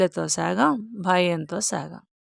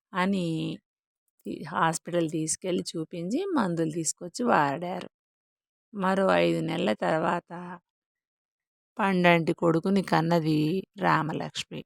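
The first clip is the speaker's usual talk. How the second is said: plain reading voice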